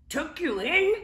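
mild bellow. Acted. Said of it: "he bellowed".

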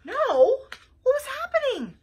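high, bewildered question; surprised detective. Acted. Flytrap shocked at chef's refusal, high and puzzled. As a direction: thrown completely off.

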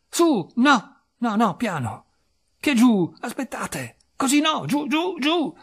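high, loud, urgent corrections; frantically steer him up and down; agitated bursts, pitched high; flustered directing. Acted. Frantic directions to trapped man.

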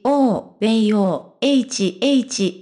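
quoting the actor's English fillers in a different voice; imitating filler sounds. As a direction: mimic the vague sounds he makes. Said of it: "エイチなどの言葉が多く". (口ごもる英語のつなぎ言葉をまねて)

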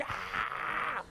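high, slow mock outrage of a little boy; angry toddler yelling. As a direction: scold the stove like an indignant toddler. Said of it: "yelled at it like".

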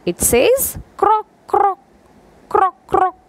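very high playful croak; frog croak imitation. Shown as a demonstration. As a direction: croak it out for the kids. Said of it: "take out the sound of the frog".

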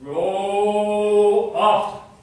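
loud, drawn-out scold; scolding disembodied voice. Acted. Disembodied voice scolding the poet, loud and drawn out. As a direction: declaim it loudly, stretching the words. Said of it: "said some non-thing".

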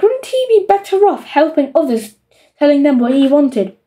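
plain reading voice

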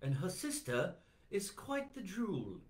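high, slow put-on British voice; mock British accent. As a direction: draw it out in comic British. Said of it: "let's pretend we're in Great Britain".

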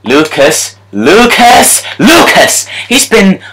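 yelling a scorer's name in joy; thrilled celebration of the second goal; high excited goal cheer. Real, not acted.

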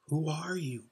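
small, soft voice in the dark; scarcely above a whisper. A narrator's reading. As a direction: ask it softly, barely daring to speak. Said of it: "scarcely above a whisper".

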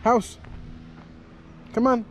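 ordinary talk

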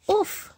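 winded little oof; startled oof; high oof. Acted. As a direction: grunt as if poked. Itch reaction.

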